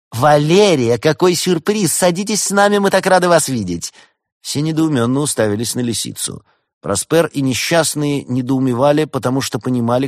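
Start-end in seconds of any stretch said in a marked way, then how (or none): 0.1-3.9 high, loud gushing welcome; over-sweet, high-pitched hostess cry; effusive greeting. A narrator's reading. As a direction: gush with exaggerated delight. Fox feigns delight to intercept cat.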